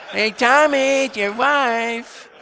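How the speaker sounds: high, loud, drawn-out sing-song taunt; mocking teasing call. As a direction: tease him like a sadistic buddy yelling across the post. Mocking cop buddies tease.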